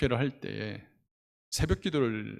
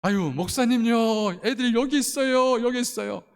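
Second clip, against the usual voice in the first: acted calling out; 8 semitones higher, 4 dB louder than usual. Bathhouse lady calling the pastor to his children, acted holler. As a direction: call across the street with urgent news.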